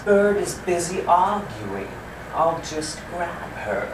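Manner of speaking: a lazy cat drawling out a sneaky plan; lazy cat, slow. Shown as a demonstration. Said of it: "speaking very slowly like this".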